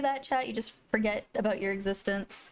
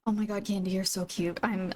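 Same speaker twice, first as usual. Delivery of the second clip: ordinary talk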